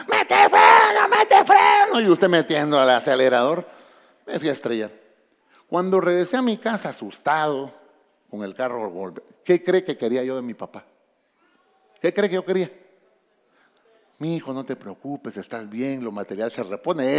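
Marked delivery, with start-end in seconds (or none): 0.1-2.0 much higher, shouted, first words slow; panicked yell. Retold or imitated. Father screaming at his teenage son driving, panicked yell. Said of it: "Las dulces palabras de su papá". 14.2-17.2 a hint of a tender father, softer; gentle reassurance. A lightly voiced father comforting his scared son, soft and gentle. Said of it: "Eso quería escuchar yo".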